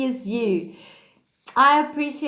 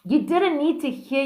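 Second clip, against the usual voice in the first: ordinary talk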